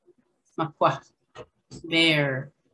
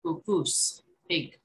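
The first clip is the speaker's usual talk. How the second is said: ordinary delivery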